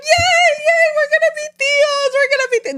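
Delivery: very high, squealing cheer; imitating siblings thrilled to become uncles and aunts; excited siblings. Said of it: "they're so excited about it".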